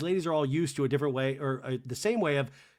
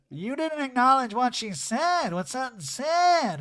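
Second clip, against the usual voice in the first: mock Erica; 9 semitones higher, 6 dB louder than usual. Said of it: "Erica to Dorit says".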